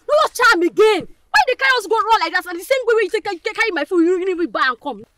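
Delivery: loud, high, heated telling-off; angry scolding. Acted. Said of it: "Just, just calm down".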